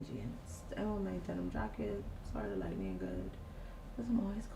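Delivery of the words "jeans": normal talking voice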